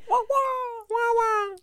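very high squawked seagull imitation; drawn-out, high-pitched gull cry; seagull call. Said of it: "a seagull was flying by".

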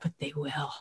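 understated, low-volume certainty; quiet conviction. Retold or imitated. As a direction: answer the doubters very softly. Brian's quiet answer to doubters.

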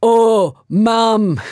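put-on loud, whiny child calling for Mum; protesting ten-year-old; Helen. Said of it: "I'm gonna do Helen".

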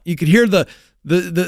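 normal talking voice

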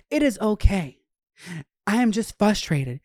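high imitated heartfelt vent; imitating a venting girl. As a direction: parody a girl airing her feelings. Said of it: "they're like".

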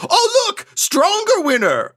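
high, slow put-on bookie voice; a squeaky-high NPC clerk, slowing down; booking agent voice. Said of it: "And then he says".

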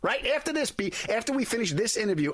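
high-pitched rapid-fire self-orders; a busy actor rattling off his day like a field general. Retold or imitated. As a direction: bark out the day's to-do list like a field general. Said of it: "I'm like a field general".

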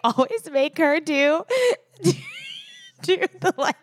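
giggling through the words; laughing hard while talking. Real, not acted. Laughing while telling about workouts.

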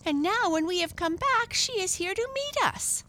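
put-on high-pitched eager boy; excited boy. A narrator's reading. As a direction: explain high and eagerly, as a child. Boy excitedly explains to mother.

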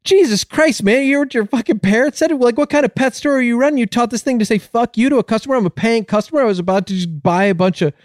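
high-pitched, acted customer outrage; very upset. Upset customer complaining to the pet store owner, high-pitched outrage. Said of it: "the guy gets very upset".

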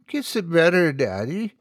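put-on high, childlike plea for a kiss; little girl asking. A narrator's reading. Small daughter begging daddy for a kiss, high and pleading. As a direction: coax like a small hurt girl. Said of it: "she asked".

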